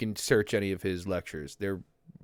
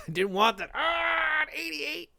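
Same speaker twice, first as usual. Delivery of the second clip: high, half-laughing fake sob; hammed-up cry of disappointment; mock crying wail. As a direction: wail over the grade in jest. Disappointed by exam grade.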